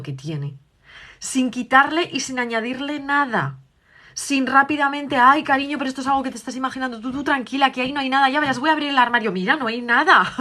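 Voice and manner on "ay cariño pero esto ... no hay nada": higher, quick brushing-off mum; dismissive reassurance. Acted. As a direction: hurry to wave the fear away. Madre quita importancia al miedo. (la madre resta importancia al miedo, tono rápido y despreocupado)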